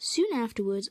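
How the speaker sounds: usual speaking voice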